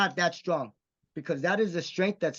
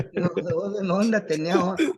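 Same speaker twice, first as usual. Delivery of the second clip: mock correction; 4 semitones higher, 5 dB louder than usual. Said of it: "Oh, what if I say tenía onda?".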